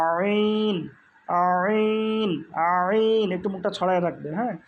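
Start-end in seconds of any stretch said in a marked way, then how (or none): 0.1-3.3 higher, long-held "আইন" pressed from mid-throat; squeezed voice. Shown as a demonstration. Teacher demonstrates the letter to students, pressed and drawn out. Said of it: "আওয়াজকে চাপাইয়া উচ্চারণ করতে হয়".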